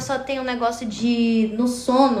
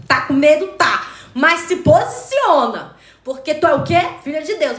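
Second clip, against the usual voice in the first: firm pep talk; 4 semitones higher than usual. Retold or imitated. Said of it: "falando assim".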